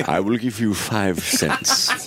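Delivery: slow, laughing haggle; laughing lowball offer. Pretend.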